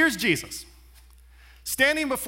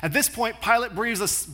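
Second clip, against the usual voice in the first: normal talking voice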